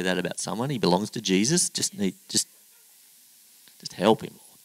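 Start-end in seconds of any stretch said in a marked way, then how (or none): none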